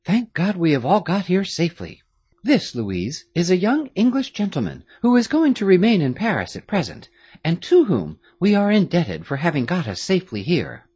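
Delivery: put-on a touch of a careful young lady; girl explaining the disguise; lightly voiced young girl. A narrator's reading. Marie voiced in dialogue.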